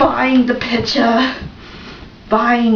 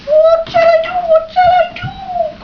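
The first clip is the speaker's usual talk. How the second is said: high, loud panicky crow voice; crow crying out; 5 dB louder than usual. Acted. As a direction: squawk it out high and desperate. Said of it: "cried old Cole".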